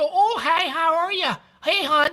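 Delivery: high-pitched Minnesota greeting; mock Minnesota accent. Showing what British people don't do, high Minnesota accent. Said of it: "a Minnesota".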